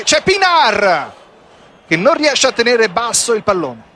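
ordinary talk

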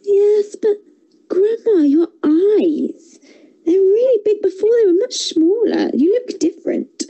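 put-on a curious girl noticing something odd; little girl voice. Girl's voice in story.

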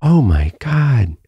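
high, drawn-out awed exclamation; slow, high-pitched imitation of her wonder; imitated giggly amazement. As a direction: retell her delighted revelation, stretching each word. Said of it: "could not stop giggling and laughing".

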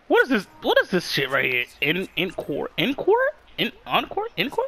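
usual speaking voice

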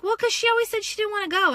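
high, fast defensive husband; imitating a husband's excuse; husband explaining. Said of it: "He's like".

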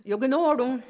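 Yoganorum said out loud and clear; Mohawk word. Shown as a demonstration. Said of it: "you can see what that would be".

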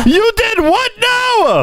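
high, drawn-out disbelief; mock outrage. Friend reacting to the toothbrush confession, high mock outrage. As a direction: stretch it out in scandalized high pitch.

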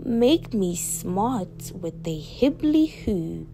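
slow, stretched-out magic chant; theatrical slow spell-casting; spell incantation. A narrator's reading. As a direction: start high and loud, drawing out each magic word.

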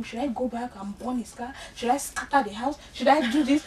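normal talking voice

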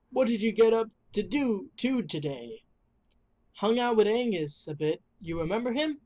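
ordinary talk, nothing marked

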